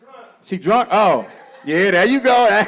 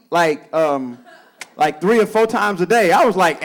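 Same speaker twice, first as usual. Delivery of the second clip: ordinary talk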